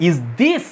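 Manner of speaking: "Is this" with a low, light "Is" and a strong "this"; stress on THIS. Shown as a demonstration. Teacher shows students where stress falls, "this" stressed after weak "Is". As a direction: hit "this" after an unstressed "Is". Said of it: "এই দিসে গিয়ে জোর পড়ছে".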